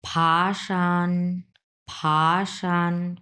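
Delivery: ফাশান very slow, rising then level; second then first tone. Shown as a demonstration. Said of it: "দুই নম্বর tone, তারপরে এক নম্বর tone".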